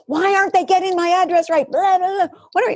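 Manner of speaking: high, indignant complaining; mock griping about the address; venting at the couch company; exasperated. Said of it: "I'm like".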